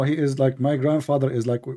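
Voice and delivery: normal talking voice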